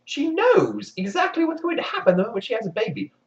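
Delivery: ordinary talk, nothing marked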